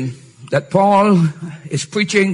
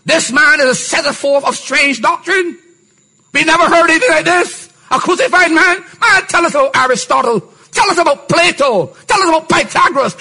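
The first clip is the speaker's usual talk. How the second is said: mocking Athenians; 10 semitones higher than usual. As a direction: sneer it like a crowd laughing him off. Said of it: "Athenians mocking Paul".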